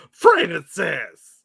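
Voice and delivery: scolding mother. Acted. Mother-in-law scolding him by name, hands on hips, exclaimed. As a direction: call out the name like a cross mother. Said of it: "put her hand on her hips and go".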